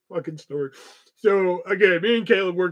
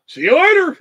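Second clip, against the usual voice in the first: high, loud sing-song goodbye; hammed-up breezy see-you-later; mock cheery shout; 11 semitones higher, 10 dB louder than usual. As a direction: toss off a bright shouted farewell. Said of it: "he's like".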